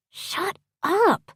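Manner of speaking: high-pitched, scolding hiss. A narrator's reading. Woman silencing her teasing partner, hissing. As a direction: hush him in a high hiss. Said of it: "she hisses".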